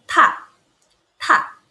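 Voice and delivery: "ta" falling sharply from high; short falling "ta" with a puff after the T; fourth tone. Shown as a demonstration. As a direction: drop "ta" short and hard, with aspiration. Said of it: "T A nada empat".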